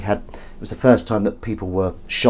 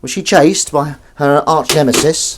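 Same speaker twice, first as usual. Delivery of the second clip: ordinary delivery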